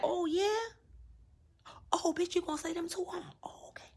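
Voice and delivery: hushed, whispery aside to oneself; mama noting it down. Retold or imitated. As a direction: mutter it under your breath, taking notes. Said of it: "she been there writing like".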